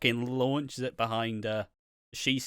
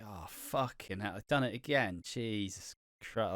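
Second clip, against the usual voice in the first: weary groan; 4 semitones lower, 9 dB quieter than usual. Retold or imitated. Flatmates at breakfast groan at Max, much softer and weary. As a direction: mutter it like you've seen this too often.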